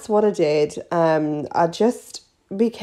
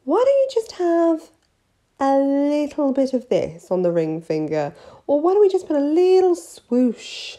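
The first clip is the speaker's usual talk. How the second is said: higher, tempting sales voice; coaxing nail tech; 6 semitones higher than usual. Retold or imitated. Nail tech tempting a timid client into nail art, high and coaxing. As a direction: dangle it like a treat. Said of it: "I'd be like".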